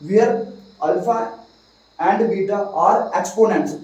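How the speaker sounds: slow dictation pace; slowly, word by word for notes; dictating. Shown as a demonstration. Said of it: "लिख दीजिए".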